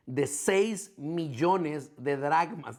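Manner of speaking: seis millones de dracmas, each word slowed and weighted; slow heavy emphasis. Real, not acted. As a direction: lean on seis and drag the phrase out. Stresses the size of debt.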